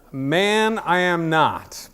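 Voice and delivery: high, loud, slow mock denial; seventies Peter. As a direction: draw out each word in exaggerated protest. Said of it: "it's just like the seventies Peter".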